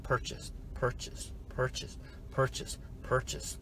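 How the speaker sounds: very soft, nearly whispered repeats; imitating alerts under the breath; hushed notifications. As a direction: drop each word quietly like a ping. Mimics notifications popping up.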